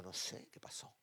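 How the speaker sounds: hushed, breathy whisper of surprise; a retold whispered "no sé"; whispered reply. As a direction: whisper it as if you're puzzled. Pastor retelling his puzzled reply.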